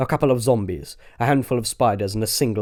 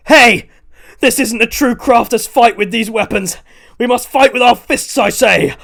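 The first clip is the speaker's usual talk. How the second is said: much higher, much louder through rain; shouted challenge; 12 semitones higher, 9 dB louder than usual. Acted. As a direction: shout over the storm. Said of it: "Milton shouted out".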